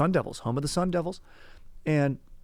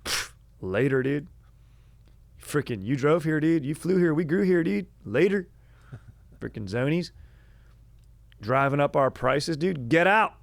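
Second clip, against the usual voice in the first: mock local taunt; 5 semitones higher, 4 dB louder than usual. Locals mocking zonies tourists.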